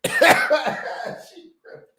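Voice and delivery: high laughing squeal; cracking up, high pitched. Real, not acted. Laughing at the lady.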